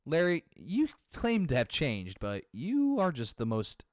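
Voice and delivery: low, deliberate put-down; deep, restrained exasperation; exasperated prosecutor. Acted. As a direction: insult with weary, measured disdain. Acted prosecutor scolding friend.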